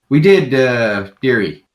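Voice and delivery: loud, slow call back; shout back. Acted. Old shopkeeper shouts back to Willow inside. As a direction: holler it back to the next room. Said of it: "she, uh, screams back out".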